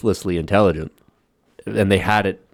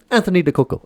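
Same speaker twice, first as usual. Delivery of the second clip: high, loud mock Italian name; Italian accent; 12 semitones higher, 4 dB louder, faster than usual. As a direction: say it like a proud Italian. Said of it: "say it again".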